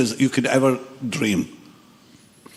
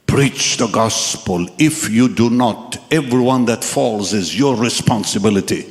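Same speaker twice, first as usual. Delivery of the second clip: solemn voice from a vision; 6 semitones lower than usual. Retold or imitated. Voice in the dream charges the young man, low and solemn. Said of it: "And I heard a voice".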